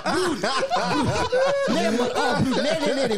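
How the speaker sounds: high laughing shout broer; cracking up loud; laughing hard. Real, not acted. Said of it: "Shake that ass". (lachend hard roepen)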